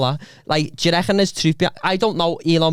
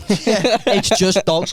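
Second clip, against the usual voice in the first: laughing, higher and drawn out; laughing repeat; 6 semitones higher, much slower than usual. Real, not acted. Laughing as he repeats his feed is all dogs. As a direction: laugh the line again slowly.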